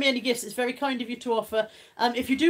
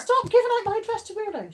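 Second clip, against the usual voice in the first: mock protest; 10 semitones higher than usual.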